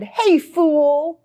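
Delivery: high, jeering schoolyard call; bully shouting a taunt across the lunchroom; mock shout. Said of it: "made everyone laugh when he shouted".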